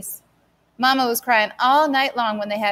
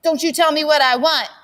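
louder, higher angry retort; voice rising; 6 semitones higher, 5 dB louder than usual. Acted. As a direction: snap back at her brother. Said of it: "Sassy's voice rose".

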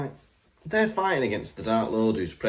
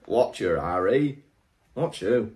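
put-on northern accent; 3 semitones lower than usual. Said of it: "giving her a northern accent".

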